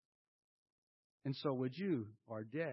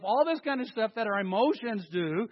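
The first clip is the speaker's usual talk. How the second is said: ordinary talk, nothing marked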